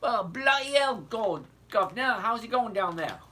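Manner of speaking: loud, high, hammed-up Aussie accent drifting British; bad Australian accent. Teasing Colton from Australia, bad Australian accent. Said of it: "that was a bad accent".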